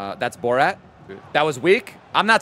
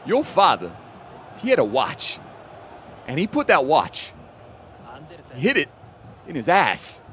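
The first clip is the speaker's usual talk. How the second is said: Christopher Walken. Retold or imitated. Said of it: "Christopher Walken?".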